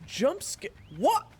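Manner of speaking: mock the one who screamed, loud and high; teasing companion. Another character teasing.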